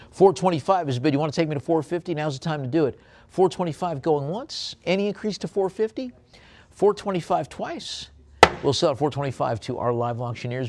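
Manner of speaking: high, loud bid calling; auctioneer call. Acted. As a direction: chase the next bid, urgent and bright.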